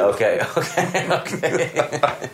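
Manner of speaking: okay broken up by real laughter; laughing okay. Real, not acted. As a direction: laugh through the okays. Laughing at a crude joke.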